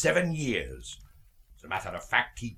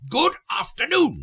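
much higher, clipped goodbye; sharp dismissal; 5 dB louder than usual. Acted. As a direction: snap the door shut with it. Scrooge repeats dismissal sharper.

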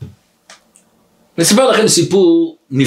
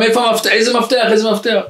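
high, repeated urgent questions; pestering questions; 6 semitones higher, faster than usual. Retold or imitated. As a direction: chase after him with the question. She runs after him asking again. (שאלות דוחקות בטון גבוה)

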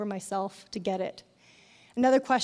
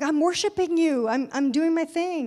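higher, louder, eagerly brushing him off; eager reply; 6 semitones higher, 4 dB louder than usual. Retold or imitated. Woman telling Jesus she is busy worshiping, eager. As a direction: answer keen and busy.

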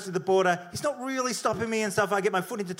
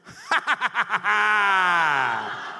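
mocking guard laugh; much slower than usual. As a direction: imitate a prankster roaring with laughter. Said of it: "sticks his head out of the guard tower and goes".

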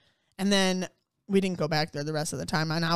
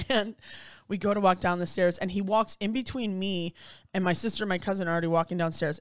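ordinary talk, nothing marked

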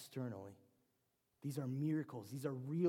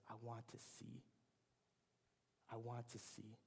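quiet plea; 10 dB quieter than usual. Retold or imitated. Blind man answers Jesus softly.

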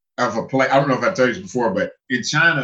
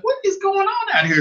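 high, incredulous retold question; mock-astonished high voice; bewildered. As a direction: act out total bafflement, pitch way up. Acting out bewilderment at crowd.

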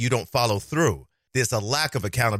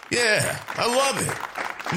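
squealed gushing; 12 semitones higher, much slower than usual. Acted. Gushing about the beach.

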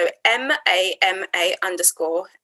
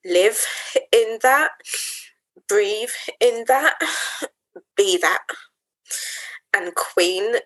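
solemn affirmation. Real, not acted. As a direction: speak each line as a sacred promise. Slow solemn affirmations, long pauses.

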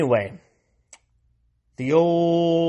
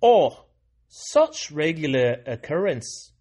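slipped into a light Irish lilt; a touch of Irish brogue on the quote; accidental Irish accent; 3 semitones higher, 4 dB louder than usual. Retold or imitated. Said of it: "I don't know why that was suddenly, you know, Irish".

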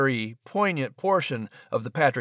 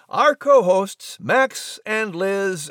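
grand entrance announcement; 5 semitones higher, much slower than usual. Acted. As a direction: stretch the names out like a ringmaster. Announcer introducing co-hosts.

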